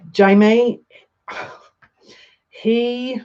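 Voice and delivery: choked-up, halting praise with long pauses; holding back tears. Real, not acted. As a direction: stop yourself crying mid-tribute. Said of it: "'cause I don't wanna cry".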